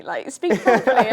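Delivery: imitating a dad snapping about her English; dad scolding. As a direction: bark it like an exasperated father.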